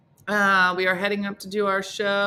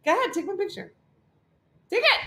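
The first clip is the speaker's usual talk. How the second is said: high, fast, eager invitation; rising, wheedling offer to take the picture; eager stranger; 8 semitones higher, faster than usual. Retold or imitated. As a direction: coax them into the photo. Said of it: "They'd be like".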